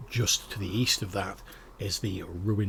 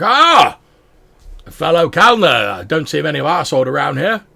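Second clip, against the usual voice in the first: excited dwarf; 6 semitones higher, 13 dB louder than usual. Acted. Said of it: "It's like".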